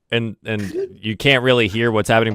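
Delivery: usual speaking voice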